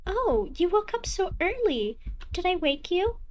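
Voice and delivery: a doting mother, casually pleased; cheery mother voice. Acted.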